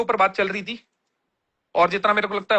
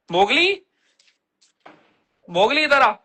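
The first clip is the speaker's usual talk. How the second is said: raised, higher call to a dog; 4 semitones higher, 3 dB louder, slower than usual. Real, not acted. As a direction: call him over from across the room. Calling his dog Mowgli.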